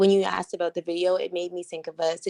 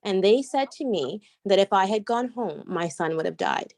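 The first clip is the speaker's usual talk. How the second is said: usual speaking voice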